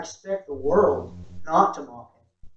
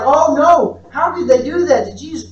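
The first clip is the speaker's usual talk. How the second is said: higher and louder, aghast at the cruelty; shocked dismay; 7 semitones higher, 8 dB louder than usual. Retold or imitated. A moviegoer reacting to the crucifixion scene, horrified and louder. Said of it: "right, you're like".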